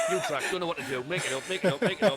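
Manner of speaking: laughing, breathless. Retold or imitated. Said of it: "I was out of breath".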